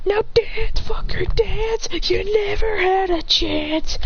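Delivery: high, drawn-out taunting cry; slow high-pitched battle boast. Acted. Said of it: "As he goes".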